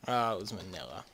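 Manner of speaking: ordinary delivery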